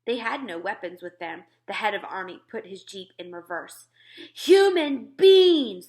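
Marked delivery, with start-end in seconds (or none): 4.3-5.9 high, slow, very loud yell; giant yelling. Acted. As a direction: stretch out the cry as a furious giant. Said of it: "the flesh lump eater yelled".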